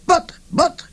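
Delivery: put-on high stammering old voice; crying out. A narrator's reading. Old man protesting as they grab his books, high cry. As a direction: sputter like a helpless old man. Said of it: "he cried".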